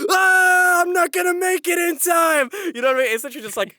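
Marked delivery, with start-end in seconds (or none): 0.1-2.5 high, loud hammed-up wail; long panicked shout, played for laughs; mock panic. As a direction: cry out in comic desperation. Acting a panicking contestant.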